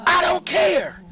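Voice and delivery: high, loud refusal; defiant shout. A narrator's reading. Nisi answering Sandra through the door, shouting.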